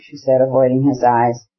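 usual speaking voice

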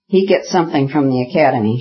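usual speaking voice

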